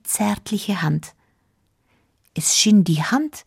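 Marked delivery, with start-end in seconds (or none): none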